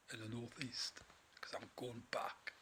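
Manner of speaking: a soft whispered punchline; whispered aside. Real, not acted. Telling viewers in the northeast to stay off the roads, a whisper. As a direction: let it slip out as a secret.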